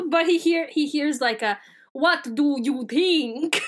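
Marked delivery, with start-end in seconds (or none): 1.9-3.6 very slow, drawn-out Transylvanian voice; Transylvanian accent. Acted. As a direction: stretch it out like a hammy Transylvanian vampire. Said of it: "the Geds are Transylvanian".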